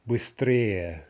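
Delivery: "быстрее" stressed on the -ее ending; shifting stress. Shown as a demonstration. Teacher showing a two-syllable example, "быстрее" with end stress. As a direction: move the stress onto -рее in "быстрее". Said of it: "shifting stress".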